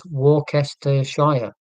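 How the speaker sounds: Worcestershire sounded out slowly as "war-kester-shire"; phonetic reading. Shown as a demonstration. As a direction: read Worcestershire as spelt, every syllable voiced, ending like "shire". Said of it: "when you look at it phonetically".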